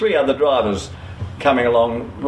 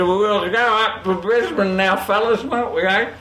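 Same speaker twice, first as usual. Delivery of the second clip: put-on slurred, sloppy drunk voice, pitched up; drunken stupid voice; 6 semitones higher than usual. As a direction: play a silly drunk bluffing the police. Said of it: "he said in a drunken, stupid voice".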